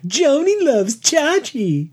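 high, slow, drawn-out movie quote; movie line delivery. Retold or imitated. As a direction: ham up the famous movie line. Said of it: "Oh, I remember that delivery".

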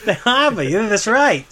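high, amused Boston dwarf; Boston accent. Acted. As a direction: confirm it in playful Bostonian. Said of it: "Dwarves are from Boston now".

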